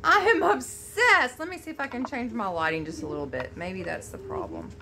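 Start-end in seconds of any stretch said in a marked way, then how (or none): 0.0-1.4 slow, loud, high emphatic gush; obsessed gush. Real, not acted. Admiring the colors, she tells viewers she's obsessed. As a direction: savor every word with delight. Gushing over palette colors.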